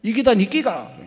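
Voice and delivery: loud blunt Gyeongsang punchline; Gyeongsang dialect retort. Retold or imitated. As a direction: snap it in thick Gyeongsang dialect. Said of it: "일본 말이지".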